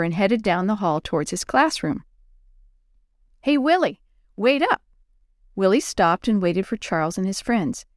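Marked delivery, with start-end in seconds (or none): 3.4-4.8 higher-pitched call to a friend. A narrator's reading. Charles calls to Willie down the school hall, calling out. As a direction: call after someone to wait up.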